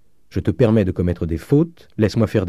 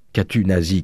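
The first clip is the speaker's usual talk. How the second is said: ordinary delivery, nothing marked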